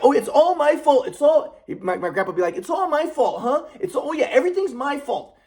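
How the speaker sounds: high mocking self-pitying voice; sarcastic grandpa. Grandpa faking blame to make grandson the bad guy, sarcastic. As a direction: play the martyr with a sneer. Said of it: "He'd be sarcastic".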